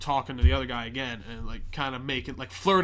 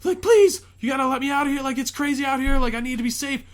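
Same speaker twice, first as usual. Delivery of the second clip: much higher, louder frantic begging; pleading woman; 13 semitones higher, 11 dB louder than usual. Retold or imitated. Infected woman begging to be let in, frantic high pleading. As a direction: beg hysterically in a high woman's voice. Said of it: "she's like".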